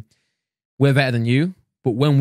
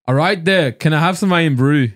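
drawn-out mock Scottish accent; party-piece Scottish accent; 3 dB louder, slower than usual. Shown as a demonstration. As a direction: put on a Scottish accent for show. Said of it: "Have you heard his Scottish accent".

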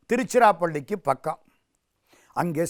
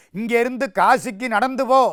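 higher, louder sentencing; stern command; 6 semitones higher, 5 dB louder than usual. Acted. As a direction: order the culprit off on his penance. Elder brother passes sentence.

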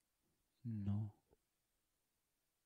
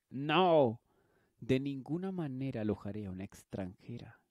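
angry refusal; 8 dB louder than usual. Acted. Farmer refusing the stranger.

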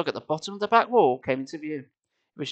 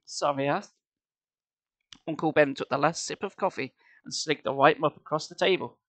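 ordinary delivery

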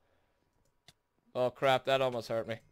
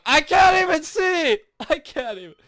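high, loud complaint; exasperated shriek; 11 semitones higher, 7 dB louder than usual. Real, not acted. Player blinded in the game, exasperated shriek. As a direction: shriek it in helpless frustration.

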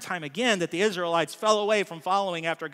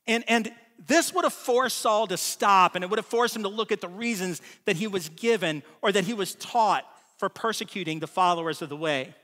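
usual speaking voice

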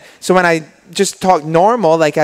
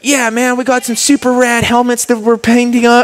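higher, laid-back Californian American voice; Troy Lee impression; 7 semitones higher than usual. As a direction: do a chill California moto guy. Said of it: "I can be Troy Lee".

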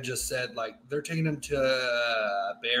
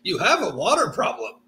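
baffled Fremen; 5 semitones higher, 5 dB louder than usual. Retold or imitated. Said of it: "He's like".

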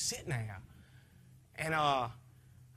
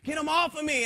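retold scream; 9 semitones higher, 4 dB louder than usual. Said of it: "I began to scream that".

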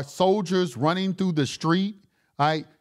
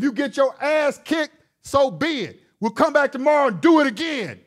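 louder and higher, shouting it out; fired-up shout; 6 semitones higher, 5 dB louder than usual. Real, not acted. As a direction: yell it like a fired-up dad. Fired-up rant about fighting bullies.

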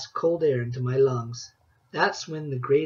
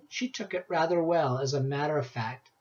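ordinary delivery

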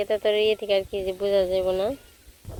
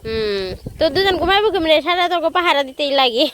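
cooing pet talk; 8 semitones higher than usual. Real, not acted. Cooing to pets Bulu and Kalu. (Blue আর কালুর সাথে উঁচু গলায় আদুরে কথা)

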